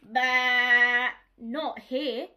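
loud pretend baa; fake sheep bleat. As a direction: bleat like a sheep to fool him. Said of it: "said Dolly, fibbing".

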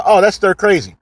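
ordinary delivery

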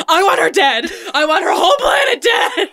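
building to a high hammed-up shout; laughing mock rage rising to a shout; mock furious shout. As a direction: yell it like a hammy villain demanding death. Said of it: "he's like".

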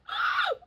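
a high, breathless gasp of surprise; sharp gasp. Real, not acted. To viewers as the jar opens, surprised gasp. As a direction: gasp at the sudden success.

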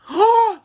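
loud, high startled gasp; sharp gasp. Real, not acted. Child spots the puppy chewing bubbles, gasping loudly. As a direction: gasp in shock at what the dog did.

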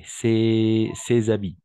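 ces habits linked, "sé-z-abi", slow; liaison. Shown as a demonstration. Teacher models the liaison for students, slow. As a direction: slowly join ces and habits with a z. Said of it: "ici, on va faire la liaison".

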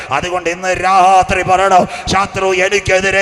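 very loud, high shouted proclamation; preaching cry. Acted. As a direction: cry it out over the crowd.

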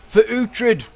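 high, loud battle cry; rally the troops with a shout; a soldier charging in, yelling his lord's name; war cry. Acted.

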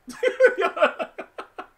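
high-pitched burst of laughter. Real, not acted. Streamer laughing at his own joke, high laughter. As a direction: crack up laughing.

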